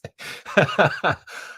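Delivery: unvoiced burst of laughter; cracking up at the chat; breathy laugh. Real, not acted.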